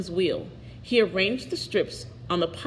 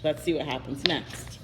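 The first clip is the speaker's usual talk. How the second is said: low, soft worried aside to the listener; softer, lower narrator aside; quiet aside; 5 semitones lower, 7 dB quieter than usual. Real, not acted. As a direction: drop the voice as if sharing a worry. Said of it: "narrator aside to listener".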